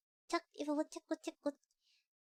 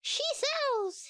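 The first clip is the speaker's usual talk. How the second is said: put-on British accent; 6 semitones higher than usual. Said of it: "reading it with a British accent".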